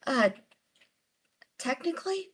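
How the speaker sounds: high, hesitant questioning admission; sheepish. Acted. Amelia admitting to the priestess she joined in, sheepish. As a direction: admit it sheepishly, pitch climbing. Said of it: "flushes a little bit".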